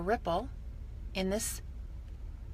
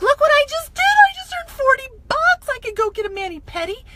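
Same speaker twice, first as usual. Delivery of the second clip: much higher and louder, squealing with delight; giddy excitement; 11 semitones higher, 7 dB louder than usual. Retold or imitated. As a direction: squeal like you just earned your first money. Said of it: "I'm like".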